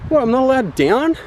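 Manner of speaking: high, slowed, indignant squeal; mock outraged disbelief. Reasonable person facing the gate, high mock outrage. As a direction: scoff at the silly gate. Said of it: "any reasonable person looks at that gate and is like".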